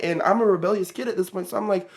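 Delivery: ordinary talk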